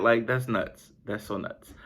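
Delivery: ordinary talk, nothing marked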